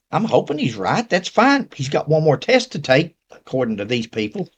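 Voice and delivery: ordinary talk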